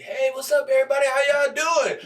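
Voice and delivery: high shouted crowd greeting; hyped opener to the audience; stage greeting. Retold or imitated. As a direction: greet the crowd big and loud. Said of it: "I go out on stage".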